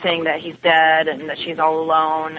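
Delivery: normal talking voice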